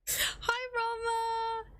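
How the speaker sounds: high-pitched squealed hello; excited greeting. Real, not acted. Greets arriving viewer in squeal.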